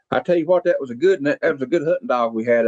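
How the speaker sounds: thick regional Southern US accent; local Southern accent. Shown as a demonstration. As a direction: lay on the down-home Southern country accent. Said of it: "around here, we talk like this".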